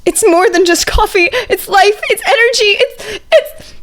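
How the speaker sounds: high, slow, building awe; overwrought gushing. A narrator's reading. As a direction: rhapsodize over the coffee like it's holy. Izuku overwrought, praising coffee.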